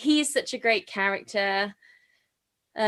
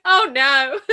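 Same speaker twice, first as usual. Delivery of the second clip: half laugh, half sob, louder; laughing cry; 3 semitones higher, 5 dB louder than usual. Retold or imitated. Fan recalling the scene, laughing through tears. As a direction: crack into tearful laughter. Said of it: "I'm like".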